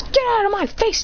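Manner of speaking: high-pitched mock annoyance; annoyed hero. Hero snaps at his enemy.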